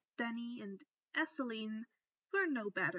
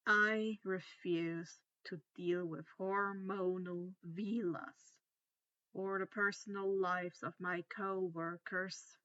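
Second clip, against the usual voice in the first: a hint of Snape's irritated growl, pitched lower; 4 semitones lower than usual. A narrator's reading. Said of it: "Snape growled".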